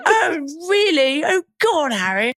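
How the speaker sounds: very high-pitched, hammed-up British accent; mock a fan's gushing fake English. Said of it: "everyone puts on a British accent".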